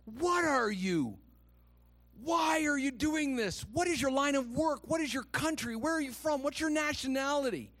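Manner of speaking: high-pitched barrage of questions; demanding. A narrator's reading. Storm-tossed crew interrogating Jonah, demanding. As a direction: fire each question high and insistent. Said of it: "they demanded".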